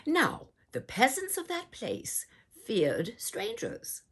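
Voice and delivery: ordinary delivery, nothing marked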